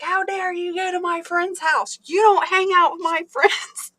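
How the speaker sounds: put-on high, indignant scolding of her kids; retold furious mom, scolding her kids; mom's angry scolding. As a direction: mimic an outraged biker mom, half laughing. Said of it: "She was like".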